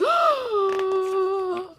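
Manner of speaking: a big acted gasp of shock; surprised gasp. Imitating the zebra mother's surprise, a loud gasp. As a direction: gasp like the startled zebra mom. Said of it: "she's making a surprise face, like".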